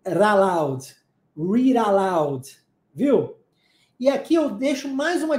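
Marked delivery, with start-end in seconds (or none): 0.0-2.5 "raloud, read aloud" said low and slow, run together; slow, lower demo of "raloud" blending into "read aloud"; linked words. Shown as a demonstration. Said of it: "e o it com aloud".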